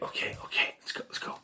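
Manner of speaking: hushed, voiceless whisper; very soft imitation of urgent whispering; mock furtive whisper. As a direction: whisper it like someone sneaking away. Acting out characters quietly sneaking off.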